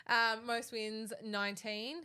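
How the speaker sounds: ordinary talk, nothing marked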